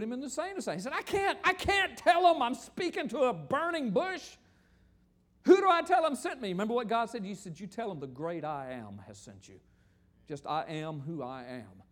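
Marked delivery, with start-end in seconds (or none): 0.9-6.5 high, loud, exasperated plea; protesting Moses. Acted. Voices Moses protesting to God. 7.6-11.8 low, soft, solemn divine answer; God. Acted. Voices God answering Moses.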